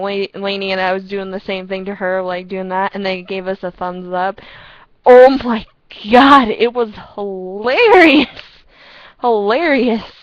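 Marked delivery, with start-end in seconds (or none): 5.0-10.1 loud slow hilarious; delighted recap of the funny moment; excited. Real, not acted. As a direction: gush with delight. Laughing recollection of the story.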